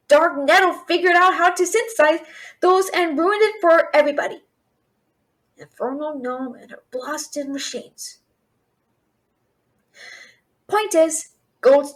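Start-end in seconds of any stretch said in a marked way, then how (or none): 5.6-8.2 much softer, slow, lower mutter; bitter aside; grumbled curse. Acted. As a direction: curse the gnome under your breath.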